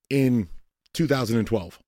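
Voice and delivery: usual speaking voice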